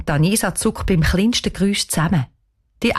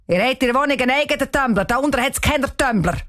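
very fast, higher caretaker voice; rebuking caretaker; 6 semitones higher, faster than usual. Acted. Caretaker sharply dismissing the girls' excuse, very fast. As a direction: shoot down the lame excuse.

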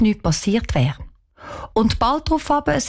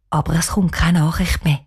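usual speaking voice

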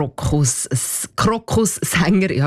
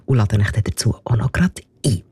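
playful whisper; 7 semitones lower, faster than usual. Real, not acted. Radio host teases listeners with a whispered invitation. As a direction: drop to a secret whisper.

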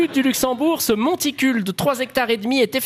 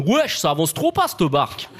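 youth slang speak; 4 semitones lower than usual. Acted. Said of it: "des trucs de jeunes comme".

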